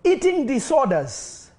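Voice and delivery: high, loud declarations of healing; full-force preaching. Acted. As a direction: call out each illness and break it.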